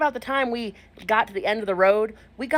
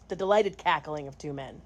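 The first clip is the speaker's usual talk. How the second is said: dry quiet repeat; 7 semitones lower, 6 dB quieter than usual. Shown as a demonstration. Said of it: "and all of a sudden I hear the delighted cackling".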